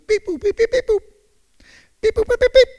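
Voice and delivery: high, chirpy beep-boop; rapid high beeping noises; imitated electronic beeps. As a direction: mimic little machine bleeps in a high voice. Imitates officer's radio or computer beeps.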